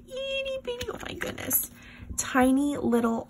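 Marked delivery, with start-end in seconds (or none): 0.1-0.9 excited squeal. Real, not acted. Marvelling at tiny envelopes. 0.9-1.7 awed whisper. Real, not acted. Awed by tiny envelopes.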